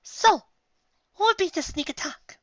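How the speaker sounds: Rarity asks lightly, high and prim; Rarity, high and refined. A narrator's reading. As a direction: pitch way up, ladylike. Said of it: "Rarity asked".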